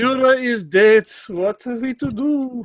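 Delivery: put-on high, slow hammy German accent; bad German accent. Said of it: "That was the worst German accent I have ever heard".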